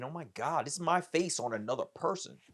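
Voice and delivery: high-pitched retold amazement; acted-out astonished realization; amazed. Amazed at seeing his own face.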